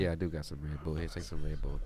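very low, soft voice kept up in an aside; vulnerable hush. Pretend. Host offering friend a drink, still hushed. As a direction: stay hushed and deep. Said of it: "I'm whispering to be vulnerable".

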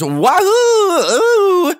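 very high, loud wahoo; squealing Mario cry. Retold or imitated. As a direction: burst in as Mario. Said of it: "jumped into the camera and goes".

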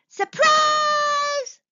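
very high, loud, long-held yell; booming shout. A narrator's reading. Bee calling thousands of bees through a bluebell, booming. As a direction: boom it out as if through a flower horn. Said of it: "voice boomed loudly through the flower".

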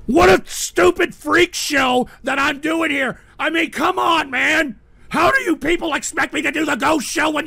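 loud, high-pitched shouted tirade; angry rant. Real, not acted. Host berating trolls ruining his show, angry rant. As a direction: yell at them in raw exasperation.

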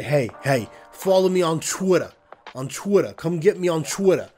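mock New York accent, wrestler on the mic; imitating a New York accent. Imitating Bully Ray's New York wrestling promo accent. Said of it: "beefed up that New York accent".

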